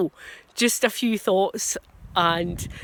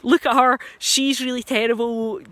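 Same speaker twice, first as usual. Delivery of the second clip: louder, higher mocking jeer; mock scorn; 4 semitones higher, 5 dB louder than usual. Imagined critic jeering to friends, hammed-up and louder. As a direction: jeer at her for the whole room to hear. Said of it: "say to their friends".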